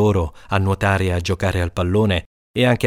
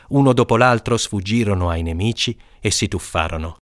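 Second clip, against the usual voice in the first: ordinary delivery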